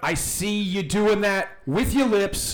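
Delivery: slow, high, indignant reprimand; loud scolding. Acted. Clyde catching a noisemaker in the act, loud and slow. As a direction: scold them loudly, drawing it out.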